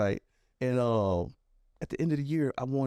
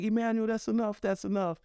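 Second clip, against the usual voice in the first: higher, lightly voiced teacher cutting in; gentle interruption; 9 semitones higher than usual. Teacher to nervous student at her retirement party, gentle interruption. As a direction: step in to stop a rambling student.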